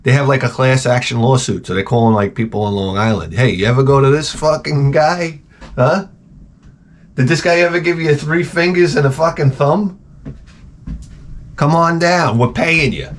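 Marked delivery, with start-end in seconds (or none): 3.3-10.0 a lightly voiced pushy ad caller, higher; mock ad pitch. Comic mocks the radio ad calling women, a pushy higher pitch. 11.6-13.1 mock ad voice.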